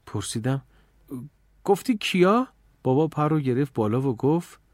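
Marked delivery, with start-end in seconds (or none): 1.6-2.5 higher and louder, startled question; shocked. A narrator's reading. Boy hears the strange bird name, shocked question. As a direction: blurt it out in shock. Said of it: "شوکه شدم".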